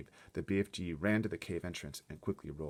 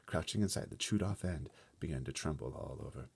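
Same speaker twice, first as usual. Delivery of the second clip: ordinary delivery, nothing marked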